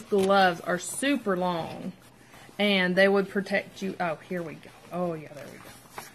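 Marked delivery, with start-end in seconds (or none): none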